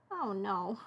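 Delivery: ordinary talk, nothing marked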